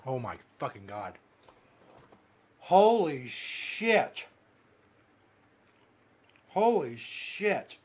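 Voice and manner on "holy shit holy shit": drawn-out pained. Real, not acted. Burning mouth, drawn-out groaning.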